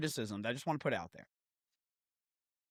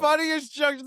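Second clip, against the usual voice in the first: usual speaking voice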